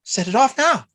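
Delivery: high, loud, excited outburst; worked-up, high-pitched exclamation; excited mock yell. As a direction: imitate someone bursting out with the order. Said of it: "I get so excited".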